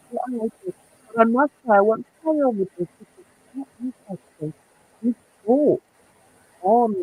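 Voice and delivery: muffled and fast, as if through a hand. Real, not acted. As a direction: speak quickly through a covered mouth. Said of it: "you were muffled".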